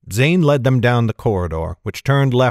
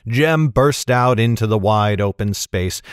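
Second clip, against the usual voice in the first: ordinary delivery, nothing marked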